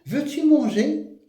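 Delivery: "veux-tu manger?" pitched high and loud, rising at the end; high emphatic demo question; question intonation. Shown as a demonstration. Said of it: "on peut dire".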